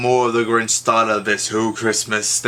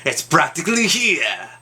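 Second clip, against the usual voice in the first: snarling Grinch; 5 semitones higher than usual. A narrator's reading. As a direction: spit it out like a sour, sneering Grinch. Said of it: "he snarled with a sneer".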